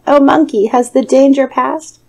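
high, loud anxious call; hopeful high call from the cage. A narrator's reading. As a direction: call out to someone passing. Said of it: "the tiger called out".

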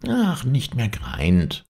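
slow, high, whiny fox; poorly fox, slow and high; sick fox. A narrator's reading. Fox feigns illness to wolf.

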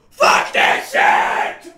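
loud drawn-out yell; shout. Real, not acted. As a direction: yell it out slowly. Shouted outburst.

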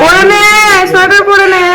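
high, pleading coax. Real, not acted. Coaxing son into a sweater.

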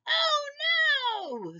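very high squeaky cry; cute germ voice. Acted. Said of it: "screamed the germ".

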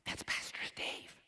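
put-on awed child whispering; excited kid whisper. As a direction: whisper it like a kid spotting a celebrity. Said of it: "I heard this little kid going".

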